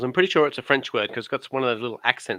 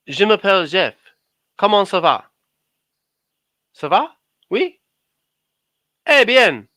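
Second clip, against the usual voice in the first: loud, higher, hammed-up French accent; 5 semitones higher, 6 dB louder than usual. Shown as a demonstration. Bragging to his cohost, he hams up a French accent. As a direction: show off your schoolboy French, French accent. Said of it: "I can do French".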